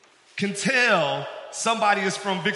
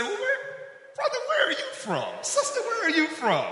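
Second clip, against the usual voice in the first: much higher, excited greeting with a laugh; greeting a fellow Southerner; 13 semitones higher than usual. Retold or imitated. Preacher imitating a friendly greeting on the Metro, high and laughing. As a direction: call out brother and sister, delighted and high. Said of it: "And you say".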